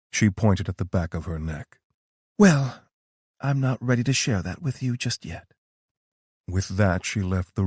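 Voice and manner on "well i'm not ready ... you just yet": higher, knowing female voice; a knowing, higher-pitched Tara; Tara's voice. A narrator's reading. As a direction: play Tara, calm and sure of herself.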